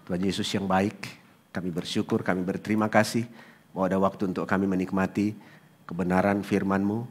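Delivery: soft, quiet prayer voice; hushed pastor's prayer; closing prayer. Acted. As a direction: pray gently over the congregation.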